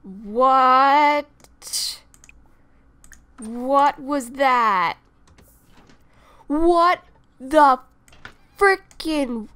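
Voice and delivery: loud, slow disbelieving yell; exasperated shout. Real, not acted. Yelling at the game, loud exasperated disbelief. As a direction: demand an answer at full volume. Shocked by sims' behavior.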